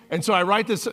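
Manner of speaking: normal talking voice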